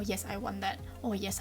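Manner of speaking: usual speaking voice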